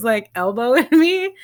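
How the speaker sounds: ordinary delivery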